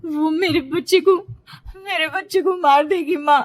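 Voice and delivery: panicked pleading. A narrator's reading. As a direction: blurt it out to mother in rising panic. Dazed daughter pleading fearfully to mother.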